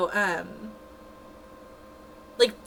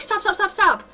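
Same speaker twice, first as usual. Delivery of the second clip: much higher, louder urgent call; urgent stop; 12 semitones higher, 6 dB louder than usual. Retold or imitated. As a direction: halt her right now, alarmed. Staff urgently halting her pushing.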